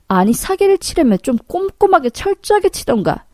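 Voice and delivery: acted angry dressing-down; scolding tirade. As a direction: lay into a swindler with scorn. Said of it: "욕을 한 사발 퍼부었어요".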